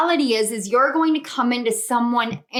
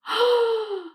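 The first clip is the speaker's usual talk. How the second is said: gasp; 9 semitones higher than usual. Real, not acted. Amazed by the reading.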